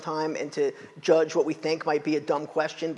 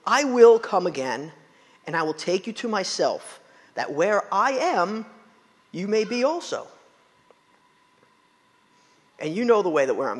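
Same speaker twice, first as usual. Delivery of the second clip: measured, earnest Bible reading; scripture reading. Acted. Pastor reading Jesus' comforting words to the congregation, solemn. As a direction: read the promise with weight.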